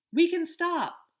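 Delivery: high, loud call; yelled from afar. A narrator's reading. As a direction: call back from up ahead. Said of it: "Miles yelled from farther up".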